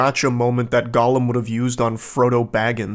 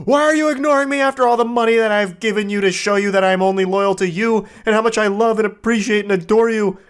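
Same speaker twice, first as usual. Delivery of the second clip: raised, high-pitched angry pleading; angry obsessed texter; 7 semitones higher than usual. Acted. Obsessed fan texting his ignored crush, angry. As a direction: demand an answer, voice pitched way up.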